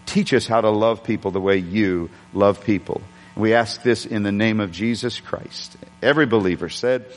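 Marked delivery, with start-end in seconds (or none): 0.1-5.8 low, earnest closing prayer. Real, not acted. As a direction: pray over the congregation.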